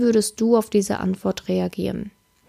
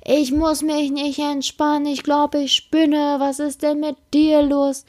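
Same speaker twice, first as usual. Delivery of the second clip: higher, indignant mock outrage; hammed-up indignant rant, pitched up; outraged reply; 5 semitones higher than usual. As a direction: play the offended girlfriend snapping back. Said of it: "dann rastest du richtig aus".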